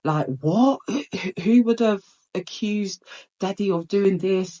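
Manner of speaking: a touch of frantic worry in her voice; imitating panic. Imitating her panicking mum on the phone, mildly frantic. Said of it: "and she's panicking".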